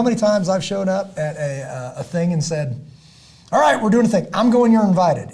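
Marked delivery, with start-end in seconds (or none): 3.5-5.3 loud announcement. Retold or imitated. Announcing the invite, retold.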